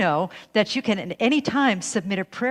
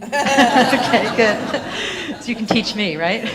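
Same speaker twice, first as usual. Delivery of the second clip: laughing; 3 semitones higher, 6 dB louder, much slower than usual. Real, not acted. Minister reacting to the practitioners' vows, laughing. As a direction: answer through a burst of laughter. Laughs after asking if they mean it.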